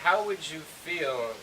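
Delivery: teacher presenting the phrase; demo phrase. Shown as a demonstration. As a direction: lean on How and slow right down. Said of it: "It's like".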